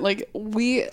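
ordinary talk

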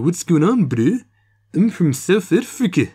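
put-on high, goofy South African accent; loud, goofy South African greeting. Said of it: "that was a good impression".

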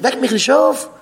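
acted high-pitched protest; retold shouting back. Sleeper answers father waking him on Shabbos, mock yell. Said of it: "Die schreien".

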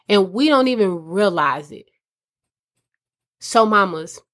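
ordinary talk

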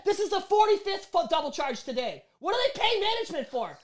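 high-pitched, hammed-up outrage; exasperated rant. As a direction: rant to a coworker behind the mute button. Muted rant to coworker Johnny.

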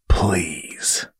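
whispered through gritted teeth. Acted. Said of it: "said through gritted teeth".

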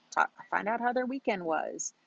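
ordinary delivery

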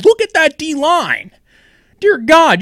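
loud, slow, high burst of amazement; amazed. Real, not acted. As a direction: really marvel at it, drawn out. Marvelling at the 49ers D-line.

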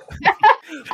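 loud shrieking laugh; high burst of laughter. Real, not acted. Laughs at Tata story.

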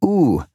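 U said as the long oo of cool, held clearly; vowel sound demo. Shown as a demonstration. Teacher models U as in cool. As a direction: voice the U as in cool for learners. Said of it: "The following symbol is pronounced".

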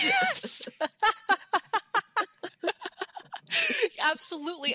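high, loud delighted yes breaking into laughter; real laughing agreement. Real, not acted. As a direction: crack up on the word, then keep laughing. Bursts out laughing at friend's joke.

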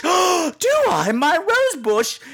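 high, startled genteel lady; scandalised British lady. Retold or imitated. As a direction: gasp out the question in prim shock. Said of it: "the conversations are always like".

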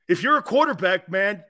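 ordinary delivery

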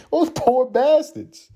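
very high, very slow, drawn-out pity; mock pity. Host pictures abused aliens, hams up a high slow lament. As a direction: whine it way up like a cartoon lament.

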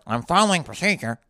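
mocking put-on voice of a rule-follower; lightly caricatured company drone; corporate dork. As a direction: imitate a dorky by-the-book employee. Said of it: "Corporate dorkerism is like".

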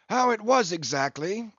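put-on a rueful old English whaler telling his loss; a hint of a weathered English captain; Englishman captain.

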